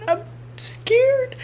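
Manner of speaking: whispered up high, small and tight; squeaky scared whisper. Real, not acted. Telling viewers she's scared, in a high squeaky whisper. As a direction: squeak it out in mock fright.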